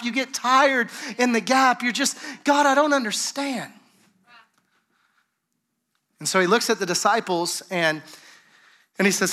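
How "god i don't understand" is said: pleading, higher-pitched complaint to God; frustrated believer begging God, higher and pressing; desperate prayer. Retold or imitated. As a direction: plead with God like you're at the end of your rope. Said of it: "you get desperate in the gap".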